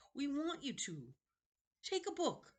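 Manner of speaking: a wheedling ancient keeper of books; creepy old librarian. Acted. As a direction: play a strange, eager old librarian. Librarian character voice.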